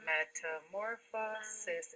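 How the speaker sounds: "metamorphosis" drawn out slowly, each syllable apart. Shown as a demonstration. Teacher shows where fa sits, "metamorphosis" said syllable by syllable. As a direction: pull "metamorphosis" slowly into its pieces. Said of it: "It's just fa".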